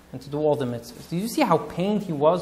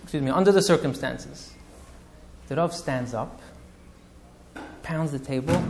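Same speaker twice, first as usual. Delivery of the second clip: usual speaking voice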